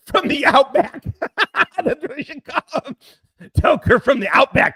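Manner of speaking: laughing through the words, high-pitched; real laughter. Real, not acted. Coining a nickname for Toker from the Outback, real laughter.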